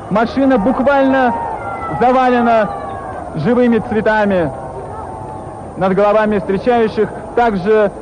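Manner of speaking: rising excitement; live play-by-play; excited commentary. Real, not acted. Live commentary as the motorcade passes.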